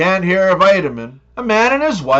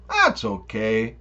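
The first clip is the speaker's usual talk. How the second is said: shrugging it off; 8 semitones lower, 8 dB quieter, slower than usual. Retold or imitated. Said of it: "But Canadians be like".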